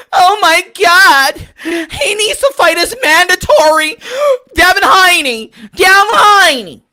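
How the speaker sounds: high, loud fake-crying whine; hammed-up sobbing complaint; mocking whiny fans. Mocking crying fans, whiny fake sob. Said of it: "bitching and crying".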